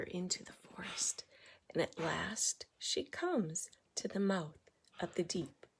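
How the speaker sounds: soft, hushed suspense narration; storyteller hushing as the heroine nears the cave; hushed storytelling. A narrator's reading. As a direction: draw them in with a whisper.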